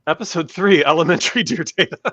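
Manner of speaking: loud, high, fighting a laugh; holding back laughter. Real, not acted. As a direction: try to keep a straight face. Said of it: "Sorry. I tried to keep a straight face".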